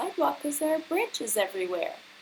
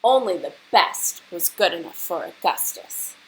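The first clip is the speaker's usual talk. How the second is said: louder, relishing list of treats; lively story reading; 8 dB louder than usual. A narrator's reading. Teacher reading the indulgent menu, relishing it. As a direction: savour each rich food for the kids.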